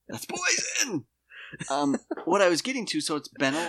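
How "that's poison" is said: a sudden high-pitched cry of outrage; high shriek. Acted. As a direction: squeal it way up high. Acted teacher's outraged reply.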